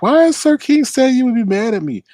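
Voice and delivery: high, loud mocking imitation; mimicked complaint.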